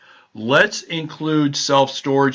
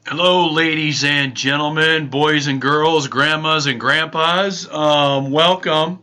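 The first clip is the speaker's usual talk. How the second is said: big showman welcome to the audience; grand entrance announcement; 4 semitones higher, 3 dB louder than usual. Acted. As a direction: boom it out like a ringmaster opening. Host opens podcast with grand greeting.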